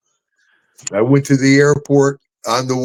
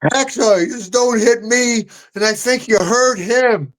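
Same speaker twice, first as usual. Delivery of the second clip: high, pleading complaint; guy protesting his friend got hit; whiny bystander; 10 semitones higher than usual. Retold or imitated. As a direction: whine it like a shaken fan. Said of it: "He goes".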